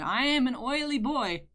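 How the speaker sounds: normal talking voice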